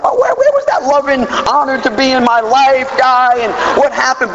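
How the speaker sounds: high, offended protest; indignant. Retold or imitated. To the sponsor who loved him, high indignant complaint. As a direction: play the hurt, betrayed newcomer.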